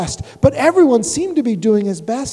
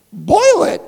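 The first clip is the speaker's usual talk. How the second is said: higher and louder gasp of alarm; shocked gasp; 8 semitones higher, 7 dB louder than usual. Acted. Horton horrified at the kangaroo's threat, higher and louder. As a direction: gasp it out in horror. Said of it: "gasped Horton".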